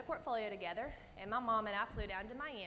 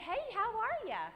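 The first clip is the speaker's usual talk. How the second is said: perky greeting; 12 semitones higher, 5 dB louder than usual. Retold or imitated. Greeting the stern judge lady.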